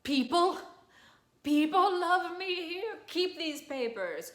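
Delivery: high, loud, slow, overcome; high emotional outburst. Acted. Nemorino moved to tears, high loud outburst. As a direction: cry it out in disbelief. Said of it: "he's like".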